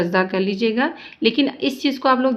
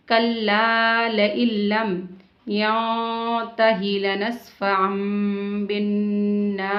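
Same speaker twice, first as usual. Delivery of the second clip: slow, drawn-out tajweed recitation; measured Quranic recitation; much slower than usual. Shown as a demonstration. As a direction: chant each word slowly, stretching the vowels. Teacher recites Quran verses.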